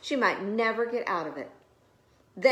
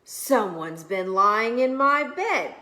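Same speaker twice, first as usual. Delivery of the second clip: loud, slow bear cry; Papa Bear; 2 semitones higher, 6 dB louder, slower than usual. A narrator's reading. Papa Bear finding someone in his bed, loud slow cry. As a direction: boom it out slowly. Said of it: "he cried".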